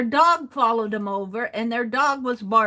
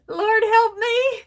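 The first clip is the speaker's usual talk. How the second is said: mock plea; 11 semitones higher, 6 dB louder, slower than usual. Exasperated plea about the kids.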